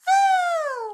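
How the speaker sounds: high, loud squeaky critter cry; tiny possum shriek, played for cute; baby possum cry. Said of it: "the little possum was going".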